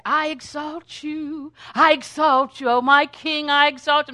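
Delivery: stirring up praise. Acted. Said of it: "I'm stirring myself up".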